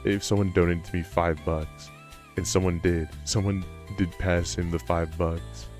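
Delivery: normal talking voice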